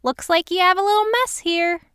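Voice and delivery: high, chirpy busybody voice; sweetly nosy middle-aged woman; nosy neighbor. Acted. Cheryl remarks on spilled groceries.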